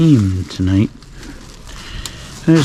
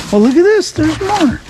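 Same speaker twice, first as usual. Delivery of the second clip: excited. Real, not acted. Excited find in dumpster.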